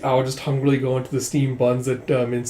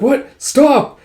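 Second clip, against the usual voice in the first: high, loud cry of alarm; panicked cry; 13 semitones higher, 8 dB louder than usual. Acted. Yona grabbed by a stranger, panicked cry. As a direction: shriek it as you are grabbed. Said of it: "it's like".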